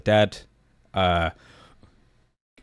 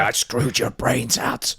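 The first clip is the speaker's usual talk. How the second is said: Triple H voice; much slower than usual. Retold or imitated. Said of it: "Triple H makes a cameo".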